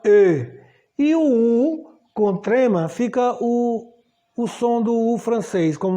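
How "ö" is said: E as a rounded "ö" sound; German O umlaut. Shown as a demonstration. Teacher naming O with umlaut, German vowel. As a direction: say E with rounded lips, as ö. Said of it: "O O com trema se chama".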